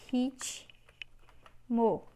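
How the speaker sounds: Ritmo drawn out slowly; teacher slowing the word down for the class. Shown as a demonstration. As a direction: sound out Ritmo at half speed. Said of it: "é o ri".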